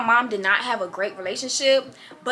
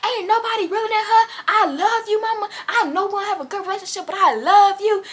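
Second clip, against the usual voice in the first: put-on drunk rambling; 10 semitones higher than usual. Drunk daughter gushing at her mama. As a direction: gush like you're wasted and love her.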